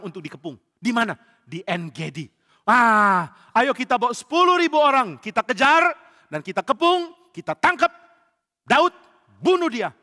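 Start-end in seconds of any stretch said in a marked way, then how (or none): 2.6-9.9 high, loud commanding shout; rally an army to the hunt; acted battle rally. Saul rallies men to hunt.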